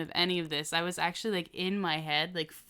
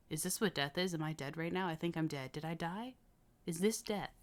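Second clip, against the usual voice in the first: imitating stoned self; 2 semitones lower, 9 dB quieter than usual. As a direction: ask it quietly, as if dazed, acting it out. Acts out stoned self on camera.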